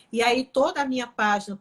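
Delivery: ordinary delivery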